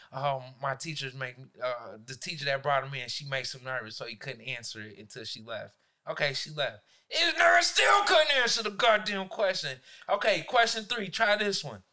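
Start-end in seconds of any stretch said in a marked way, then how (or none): 0.1-5.7 lower, softer mumbling excuse; a hint of a sheepish student; student's excuse. Student making excuses to his teacher, lightly voiced and meek. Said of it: "first he was like". 7.1-9.8 louder, higher rant; bursting with frustration; exasperated shout. Real, not acted. Teacher ranting to friends about a failing student, shouting.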